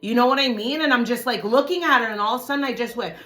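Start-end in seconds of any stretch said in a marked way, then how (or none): none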